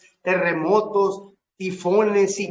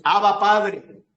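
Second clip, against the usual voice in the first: loud, drawn-out, raised-voice invocation; crying out to God; 5 semitones higher, 7 dB louder, slower than usual. Acted. Congregation's cry to the Father in prayer, loud crying out. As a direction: call out slowly and strongly to the Father. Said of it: "Así clamamos".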